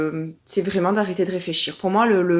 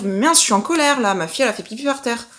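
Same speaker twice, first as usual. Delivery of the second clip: acted annoyance; 3 semitones higher, 3 dB louder than usual. Mother voicing permitted anger at a puddle on the floor, annoyed. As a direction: blurt it out, allowing yourself to be cross. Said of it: "T'as le droit de te dire".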